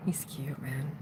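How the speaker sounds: low whisper to the camera. Real, not acted. He sits right in front of her, whispered confession. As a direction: keep it secret from him. Describing the man nearby.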